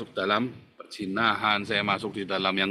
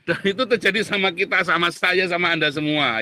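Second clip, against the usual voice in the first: laughing; 6 semitones higher, 5 dB louder than usual. Real, not acted. Laughing confession to congregation.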